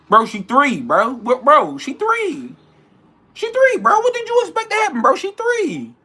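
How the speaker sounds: very high, loud outraged squeal; near-falsetto exasperation; squealing disbelief. Real, not acted. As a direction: shriek "she three" in disbelief. Incredulous rant about beating toddler.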